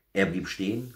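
usual speaking voice

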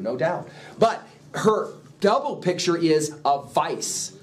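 usual speaking voice

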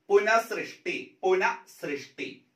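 പുനഃസൃഷ്ടി said slowly with the breathy visarga after പുന; correct form demo. Shown as a demonstration. Teacher to exam students, slow correct പുനഃസൃഷ്ടി. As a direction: draw out പുനഃസൃഷ്ടി, not പുനർസൃഷ്ടി. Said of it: "ശരിയായ പ്രയോഗം ഇതാണ്".

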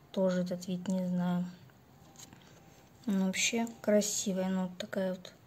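usual speaking voice

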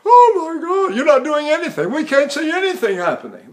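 high amazed voice; amazed doctor. Retold or imitated. Throat doctor amazed at the vocal cords. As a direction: gasp it in amazement. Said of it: "They go".

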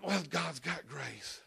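mock churchgoer waving it off; mock dismissive. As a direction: brush sin aside with "grace". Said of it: "It's like".

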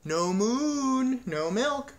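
high, drawn-out insistence; emphatic confirmation. A narrator's reading. Cow confirms her ultimatum to the farmer, emphatically. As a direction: hold MOON long and high, refusing to budge. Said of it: "Martha confirmed".

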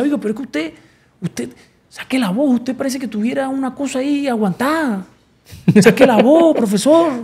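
playing the mentor pushing him to sing out; imitating the mentor. Mentor voiced urging to sing out. (imitando al maestro, voz aguda de entrenador insistente)

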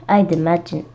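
plain reading voice

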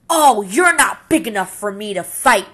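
loud, sniffy brush-off; blustering excuse. Acted. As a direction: pretend you never wanted to fight. Grouchy ladybug backs off.

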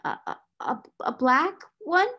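nervous stammer, rising high on the end. Acted. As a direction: stumble over the words nervously. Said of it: "stammered again".

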